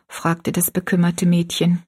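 ordinary delivery, nothing marked

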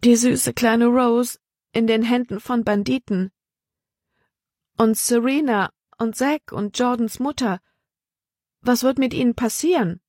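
put-on high, worried young woman's lament; anxious dread. A narrator's reading. Libby voiced, fearing for friends.

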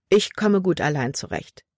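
ordinary delivery, nothing marked

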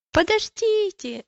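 high, drawn-out call to wait; acted cry, pitched high and stretched. A narrator's reading. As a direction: call after departing friends. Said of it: "закричала она, задыхаясь от быстрого бега".

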